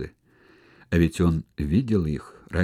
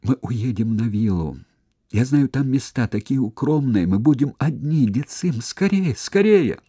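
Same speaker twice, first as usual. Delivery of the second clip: hurried, stammering plea rising high; fearful whisper; 6 semitones higher, 4 dB louder than usual. Acted. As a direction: plead with growing panic. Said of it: "зашептала".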